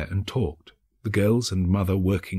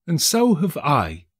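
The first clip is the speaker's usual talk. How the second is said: exclaimed; 6 semitones higher, slower than usual. A narrator's reading. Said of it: "exclaimed another".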